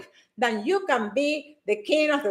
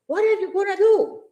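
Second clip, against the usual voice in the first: incredulous question; 6 semitones higher than usual. Acted. Rhetorical challenge to viewers.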